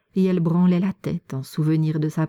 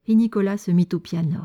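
ordinary delivery

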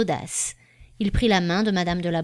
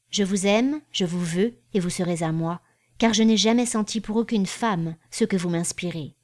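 ordinary delivery

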